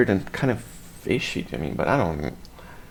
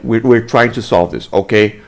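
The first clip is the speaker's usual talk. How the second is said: composed, lower-pitched reassurance; reasonable officer; 4 semitones lower than usual. Acted. Officer calming an annoyed citizen, composed and reasonable. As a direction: say it evenly, like a professional cop. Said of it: "this is how a normal human being would react".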